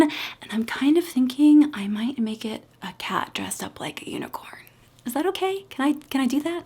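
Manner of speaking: hushed conspiratorial whisper. Real, not acted. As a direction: whisper it like a secret. Confiding a secret plan.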